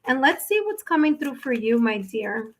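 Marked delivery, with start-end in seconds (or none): none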